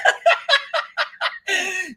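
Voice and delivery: high-pitched cackling; high run of laughs; real laughter. Real, not acted. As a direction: keep cracking up. Reacts to played clip.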